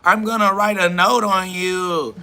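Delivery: hammed-up tattletale taunt; mock tattletale voice. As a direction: parody a petty snitch. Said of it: "They just like".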